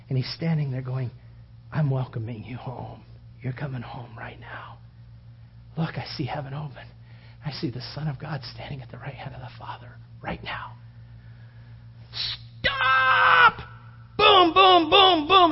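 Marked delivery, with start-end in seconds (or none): 1.7-10.7 soft, unvoiced welcoming hush; Jesus softly welcoming his child; tender whisper. 12.6-15.5 very high, loud shouted booms; mob yell and stone impacts. Retold or imitated. Stones landing, mob yelling.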